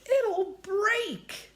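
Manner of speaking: high, slow frightened pleading; afraid. Acted. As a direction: plead in fear. Fearful warning at branch.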